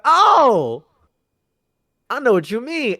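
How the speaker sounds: high, loud knowing oh; a sly high-pitched realization; knowing Usopp. Retold or imitated. Retelling Usopp catching on.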